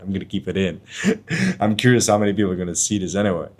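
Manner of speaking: normal talking voice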